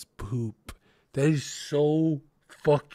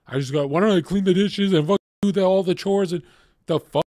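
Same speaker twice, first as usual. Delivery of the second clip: fast, louder and higher outraged tirade, tumbling out; indignant rant; 5 semitones higher, 6 dB louder, much faster than usual. Real, not acted. Host ranting to chat that diapers are beneath him, loud and fast. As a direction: spit it out in disgust, barely pausing.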